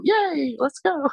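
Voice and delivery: high, bright mock cheer; imitating an overexcited dog owner; mock excited cheer. Humans hyping dogs on walk.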